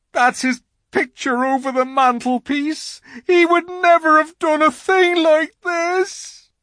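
loud, high tearful lament; wailing faun. A narrator's reading. Faun ashamed before his father's picture, wailing. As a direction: lament at full volume.

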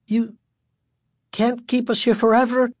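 high, frightened protest; growing terror. A narrator's reading. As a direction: cry out with terror creeping in. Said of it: "unable to keep the growing terror from her voice".

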